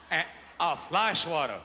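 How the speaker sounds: slow, drawn-out fly swatter naming. Real, not acted. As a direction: stretch each word out slowly. Audience call and drawled answer.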